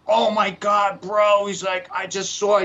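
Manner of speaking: a high, hammed-up panicked friend; freaked-out friend.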